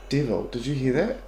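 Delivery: hushed, tense whisper to a partner; nervous night vlog whisper. Real, not acted. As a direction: breathe it out nervously in the dark. Hears voice on spirit box.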